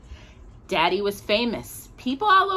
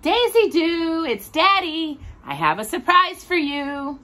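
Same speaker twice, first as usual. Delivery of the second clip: a hint of a higher, playful Daddy voice; 7 semitones higher than usual. A narrator's reading. Daddy knocking at Daisy's door, lightly playful. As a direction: tease the surprise gently.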